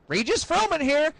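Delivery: high, perky phone greeting; Regis Philbin. Retold or imitated. TV host answers a call from jail, high showman voice. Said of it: "That motherfucker answers the phone, and he's like".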